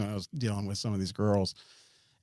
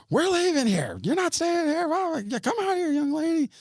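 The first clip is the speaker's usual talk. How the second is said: imitated yelling. Retelling calling the girl out of the house, high imitated yell. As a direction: call out high as if to someone indoors. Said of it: "I start yelling the girl's name".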